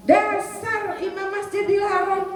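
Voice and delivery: high, slow, mock-furious scold; a mother's exasperated curse, half joking; angry mother. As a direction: scold him like a cross mother. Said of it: "kalau marah begitu tuh emaknya".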